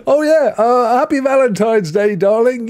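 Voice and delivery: a breezy high offhand husband's voice, lightly played; mock offhand partner. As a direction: toss off the greeting like a forgetful partner. Mock casual wish to partner.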